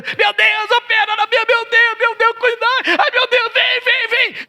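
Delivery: mock the panicked believer begging God; desperate crying out. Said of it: "desesperadamente clamando a Deus". (clamor desesperado e agudo, imitado)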